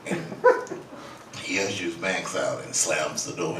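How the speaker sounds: normal talking voice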